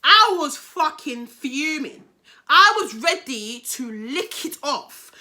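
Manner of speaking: slow, loud, hammered-out emphasis; recalled fury. Retold or imitated. Storyteller telling viewers how fuming she was, emphatic. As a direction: stress each word of your retold rage. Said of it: "I wasn't angry".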